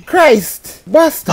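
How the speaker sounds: high angry yell; yelling across the room. Real, not acted. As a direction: shout the curse out. Yelling at enemy in game.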